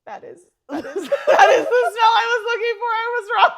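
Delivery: high and loud, laughing through it; laughing admission I was wrong; gushing realization. Retold or imitated. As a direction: squeal that this is the smell after all. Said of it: "I'm like".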